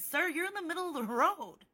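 high, drawn-up protesting "sir"; retold remark pitched high, without hostility; not rude. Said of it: "I was not rude".

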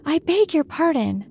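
high-pitched, meek apology; very humble. A narrator's reading. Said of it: "said Alice very humbly".

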